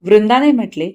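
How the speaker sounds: ordinary delivery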